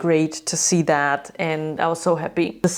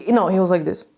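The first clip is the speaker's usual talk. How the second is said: ordinary talk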